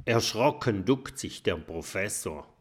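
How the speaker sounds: plain reading voice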